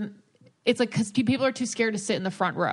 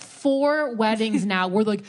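ordinary talk

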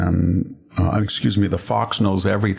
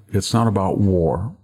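normal talking voice

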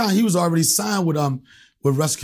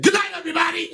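triumphant exit; 13 semitones higher, faster than usual. Retold or imitated. Leaving stage after getting booked.

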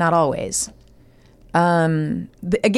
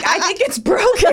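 quoting Logan; 11 semitones higher than usual. Retold or imitated. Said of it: "And he's like".

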